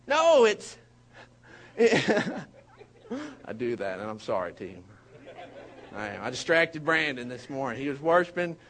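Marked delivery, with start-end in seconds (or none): none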